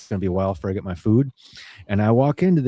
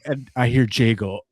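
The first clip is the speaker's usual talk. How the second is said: usual speaking voice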